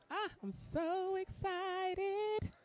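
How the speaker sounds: high, slow, stretched squeal; excited squeal. Real, not acted. Host squealing as the guest approaches, slow and high. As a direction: savour it like a happy shriek.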